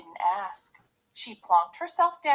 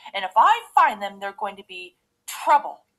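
loud threat, quick at first; Daddy Bear; 9 dB louder than usual. A narrator's reading. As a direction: warn loudly as the angry father bear. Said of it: "growled Daddy Bear".

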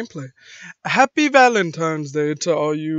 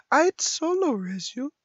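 same line pitched way up; higher put-on game-character voice; 8 semitones higher than usual. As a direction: lift it into a high, questioning voice.

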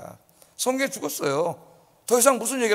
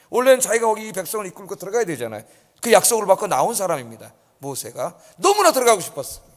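ordinary delivery

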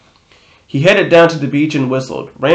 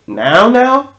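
rising high in disbelief; shocked, pitching way up; incredulous; 10 semitones higher, 3 dB louder than usual. Acted. Can't believe he leaves now.

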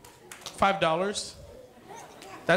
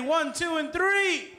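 building countdown; 8 semitones higher, slower than usual. Real, not acted. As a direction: count rising higher to a shouted three. Leading crowd countdown.

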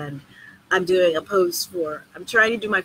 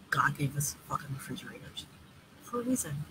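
whisper; 4 semitones lower, 11 dB quieter than usual. Real, not acted. Joking conspiratorial aside.

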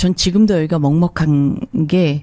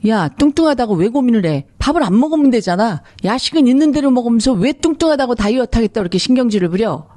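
acted nagging, pitched higher; higher-pitched mock scolding; scolding parent; 5 semitones higher than usual. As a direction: imitate an exasperated parent telling off a child. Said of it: "아이들을 야단칠 때도".

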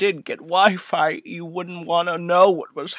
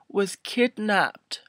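ordinary delivery

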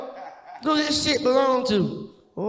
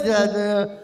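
ordinary delivery

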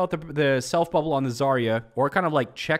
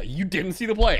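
usual speaking voice

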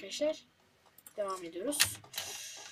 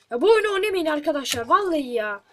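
angry rant; 4 semitones higher, 8 dB louder than usual. Real, not acted.

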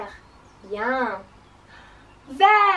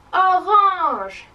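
high, loud call; really loud voice; 6 semitones higher, 7 dB louder than usual. Shown as a demonstration. Teacher leads class repeating French colours, loud voice. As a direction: belt the word out. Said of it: "in our really loud voices".

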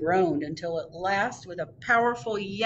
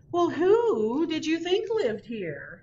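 much higher, slow hooting owl voice; great horned owl hooting loudly at Earl; 10 semitones higher than usual. Acted. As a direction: hoot it out like an indignant owl. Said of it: "hooted the great horned owl loudly".

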